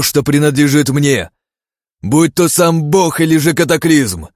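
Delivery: loud, commanding fury; furious declaration. Acted. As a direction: thunder it at the usurper. Hero denounces the usurper.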